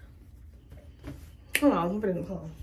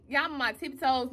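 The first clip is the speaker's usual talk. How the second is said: ordinary talk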